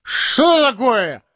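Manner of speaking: slow, high-pitched roar; drunken roar. A narrator's reading. Drunk comic bumps into someone tall, roaring. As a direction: roar it out, drawn out. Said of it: "заревел он".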